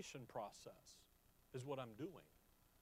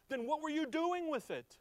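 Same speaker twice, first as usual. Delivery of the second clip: much higher, insistent question; pleading challenge; 11 semitones higher, 5 dB louder than usual. Real, not acted. Preacher confronting his listeners, high and imploring.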